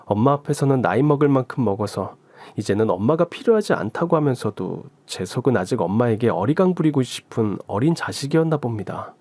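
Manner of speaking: ordinary delivery, nothing marked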